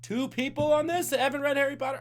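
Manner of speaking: can't believe it, playfully; host shocked that two players skipped Harry Potter; surprised disbelief. Real, not acted. Host reacting to quiz answers.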